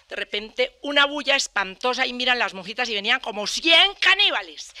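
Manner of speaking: normal talking voice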